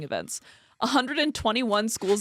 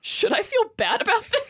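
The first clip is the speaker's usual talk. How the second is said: high-pitched, amused question; squeaky, half-laughing appeal; giddy pleading; 11 semitones higher than usual. Real, not acted. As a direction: ask it high, on the edge of laughter. Laughing plea for a verdict.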